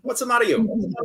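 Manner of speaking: loud, high Bronx tough talk; Bronx accent. Acted. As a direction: lean hard into a mock Bronx accent. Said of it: "try and not do fucking the Bronx".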